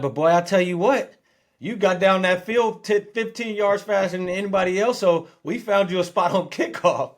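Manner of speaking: the coach delivering good news; coach praising. Retold or imitated. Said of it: "He's like".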